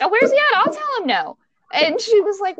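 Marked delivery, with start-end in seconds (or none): 0.0-1.4 high-pitched, feisty retort; retold indignation. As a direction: replay yourself taking charge in an argument. Retold indignant argument with friend.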